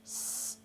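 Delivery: voiceless sharp س hiss; whistled s sound. Shown as a demonstration. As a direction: breathe out a whistling س. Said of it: "ایک تو".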